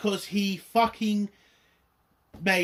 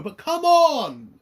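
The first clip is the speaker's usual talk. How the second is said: slow, much higher and louder; exasperated plea; 9 semitones higher, 8 dB louder, slower than usual. Real, not acted. Fed-up reviewer pleading with the writers, slow exasperated. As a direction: drag it out in frustration.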